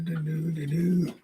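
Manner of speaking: normal talking voice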